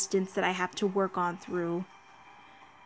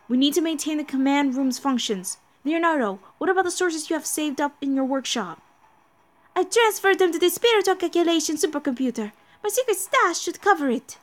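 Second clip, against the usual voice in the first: higher, urgent emergency report; lightly voiced crisis crew; 6 semitones higher than usual. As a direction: read it like a bridge crew in alarm. Voices anime crisis dialogue.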